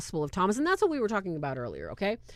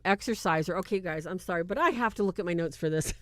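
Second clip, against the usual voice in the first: ordinary delivery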